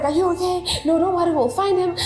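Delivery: imitating worried bystanders, high-pitched; high, concerned crowd voices; retold urgent concern. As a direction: voice their fussing reassurance in a high register. Bystanders fussing over her after assault.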